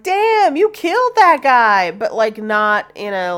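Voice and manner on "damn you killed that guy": high, loud impressed exclamation; mock-impressed teenager; amazed quote. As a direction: gush like you're impressed. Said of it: "They were just like".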